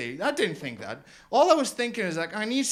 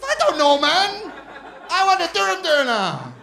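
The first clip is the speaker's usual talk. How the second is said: much higher, louder fed-up yell; exasperated shout; 9 semitones higher, 9 dB louder than usual. Retold or imitated. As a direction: snap back at the nosy kebab guy. Exasperated with shopkeeper.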